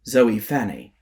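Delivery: plain reading voice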